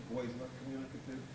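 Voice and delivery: usual speaking voice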